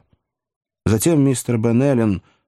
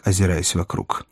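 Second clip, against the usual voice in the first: ordinary delivery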